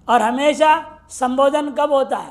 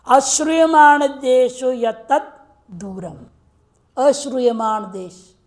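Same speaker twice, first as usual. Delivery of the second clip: slow, emphatic recitation of the rule; sutra recitation; 4 dB louder, slower than usual. Shown as a demonstration. Teacher to students, slow emphatic sutra recitation. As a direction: chant it out, weighing each word.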